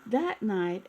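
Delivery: usual speaking voice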